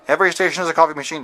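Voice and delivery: rattled off fast like an auctioneer; rapid-fire auctioneer patter; auctioneer talk. Pretend. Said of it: "I'm talking like an auctioneer".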